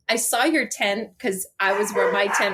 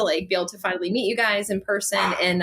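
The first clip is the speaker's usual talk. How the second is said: ordinary delivery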